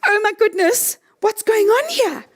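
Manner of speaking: high-pitched mock astonishment; startled brain. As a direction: play a brain jolted by a head rush. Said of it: "your brain's gonna be going".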